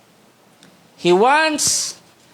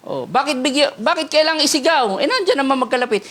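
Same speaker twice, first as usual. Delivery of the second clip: mock listener complaint; 6 semitones higher, faster than usual. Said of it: "yung mga nakikinig".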